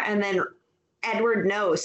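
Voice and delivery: usual speaking voice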